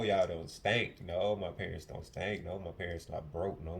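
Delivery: usual speaking voice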